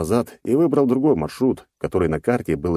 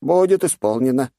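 submissive advisor; 7 semitones higher, 3 dB louder than usual. Acted.

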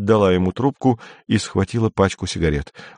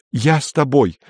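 higher, brazen, picking a fight; the wife starts a quarrel, higher and insolent; 6 semitones higher than usual. A narrator's reading. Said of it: "особенно наглым высоким голосом". (нагло, высоким голосом)

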